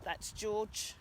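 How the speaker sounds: normal talking voice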